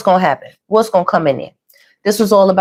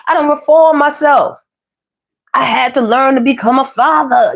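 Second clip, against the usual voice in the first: higher-pitched put-on [name] accent; [name]'s accent; 5 semitones higher than usual. Reader quoting [name] on fatherhood, playful accent impression. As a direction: mimic [name]'s accent with relish. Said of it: "Y'all know how [name] talk".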